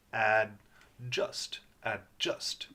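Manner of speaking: "Adjust" split after AD, D cut off before JUST; stopped D. Shown as a demonstration. Teacher modelling the right way, stopped D. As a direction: say "Adjust" in two pieces, no vowel after D. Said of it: "The D stops the sound".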